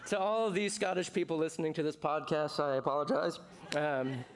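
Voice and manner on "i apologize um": normal talking voice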